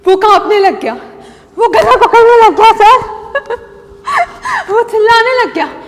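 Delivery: high, very loud, laughing hysterically; crazed glee. Acted. Wife describing husband choking, manic and laughing. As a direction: shriek it with deranged delight.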